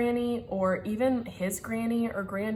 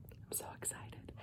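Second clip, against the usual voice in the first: barely audible, breathy whisper; excited whisper; 25 dB quieter than usual. Real, not acted. Vlogger confiding in viewers, excited whisper. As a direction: whisper it like a hushed secret. Hushed aside, husband recording nearby.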